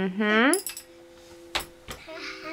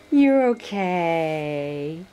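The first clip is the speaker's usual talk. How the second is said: slow low reassurance; 7 semitones lower, much slower than usual. Real, not acted. Soothing baby.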